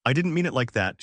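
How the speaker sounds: usual speaking voice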